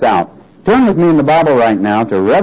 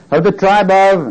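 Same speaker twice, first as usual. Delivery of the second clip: "Of the tribe of" raised high and left hanging; emphatic lead-in; 7 semitones higher than usual. Shown as a demonstration. Preacher reading out the tribes to the congregation, emphatic. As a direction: lift "Of the tribe of" high to set up the next word. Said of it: "now I'm emphasizing a word".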